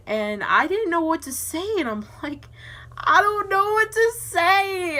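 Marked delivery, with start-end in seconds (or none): 3.0-5.0 high, loud, drawn-out helpless wail; mock panicked wail. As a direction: wail it slowly in hammed-up panic. Acting out panic before mom.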